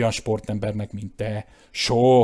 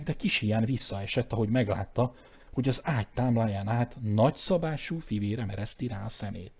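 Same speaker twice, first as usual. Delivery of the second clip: plain reading voice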